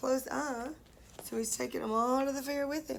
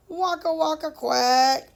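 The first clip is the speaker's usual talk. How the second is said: louder quacky duck snore; quacking duck; 2 semitones higher, 7 dB louder than usual. Acted. Teacher voicing the duck's snore for the class, loud quacking.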